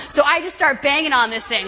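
ordinary talk, nothing marked

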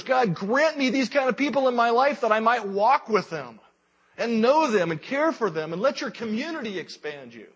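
high, loud impassioned prayer; fervent preaching prayer. Acted. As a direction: plead it out to God, full voice. Preaching prayer at full force.

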